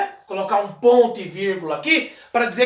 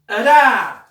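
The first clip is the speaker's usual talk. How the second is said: "Ra" punched louder and clear; syllable called out; 5 dB louder than usual. Shown as a demonstration. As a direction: hit the syllable "Ra". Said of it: "separando as sílabas".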